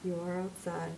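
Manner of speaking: soft, low echo of the announcement; calm facility voice. Acted. [name] quotes the building's voice, soft and calm. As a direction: quietly mimic the gentle voice.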